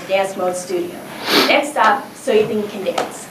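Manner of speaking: usual speaking voice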